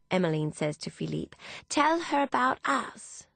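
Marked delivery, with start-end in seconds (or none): 1.7-3.3 high, drawn-out eager plea; eager urging. Acted. Emmeline urging Philippe to tell, eager. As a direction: coax him slowly, pitched way up.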